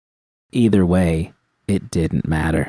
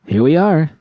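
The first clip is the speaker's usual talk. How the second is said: higher, bright cheerful announcement; announcing; 8 semitones higher, 3 dB louder than usual. A narrator's reading. Judy arriving at the cottage with V, cheerfully announcing. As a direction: call it out proudly on arrival. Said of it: "I announced".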